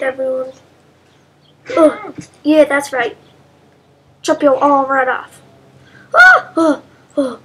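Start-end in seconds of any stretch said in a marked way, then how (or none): 6.1-6.5 sudden high shriek; short high startled scream. Real, not acted. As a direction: shriek in surprise. Arm chopped off.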